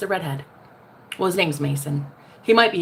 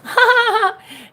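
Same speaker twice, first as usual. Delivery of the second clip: high burst of laughter; 13 semitones higher, 6 dB louder than usual. Real, not acted. Laughing at her joke.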